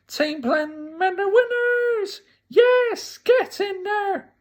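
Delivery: very high, stretched-out victory cry; hammed-up high-pitched celebration; triumphant announcement. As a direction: proclaim the winners in a squealing cheer. Retelling host announcing the winners.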